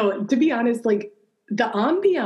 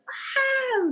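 imitated scream. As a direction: imitate everyone screaming, high but held back.

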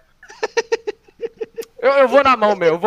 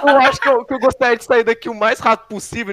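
urgent; 4 semitones higher, 5 dB louder than usual. Real, not acted. Player urgently wants out.

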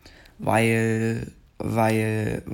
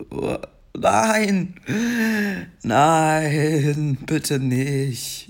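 despairing cry; 5 semitones higher, 3 dB louder, much slower than usual. Real, not acted. Streamer fails level.

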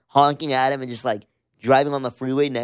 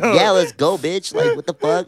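higher and louder, a squeaky mock tough guy; 8 semitones higher, 4 dB louder than usual. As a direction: play Adrian as a shrill hothead. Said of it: "Why like that voice?".

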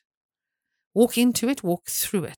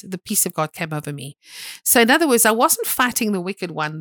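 ordinary delivery

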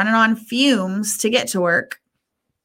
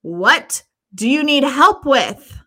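slow exasperated; 4 semitones higher, 3 dB louder, slower than usual. Retold or imitated. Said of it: "and I said".

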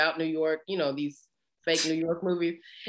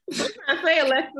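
mock offended; 9 semitones higher, 3 dB louder, faster than usual. Real, not acted.

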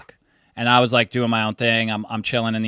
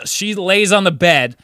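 ordinary talk

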